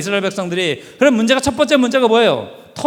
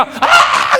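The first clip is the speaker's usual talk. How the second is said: high shrill squawk of a flying chicken; bird screech; 5 dB louder than usual. Retold or imitated. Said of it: "이러면서 날아오는 거죠".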